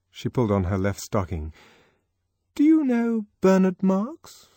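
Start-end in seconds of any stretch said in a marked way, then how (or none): 2.6-4.5 high, loud, too-casual question; forced casualness. A narrator's reading. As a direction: fake being offhand. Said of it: "excessive casualness was evidently forced".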